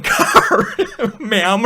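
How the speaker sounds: breaking up laughing mid-quote; laughing through words. Real, not acted. Storyteller voicing the stranger in the wrong car, laughing. Cracks up voicing the mistaken driver.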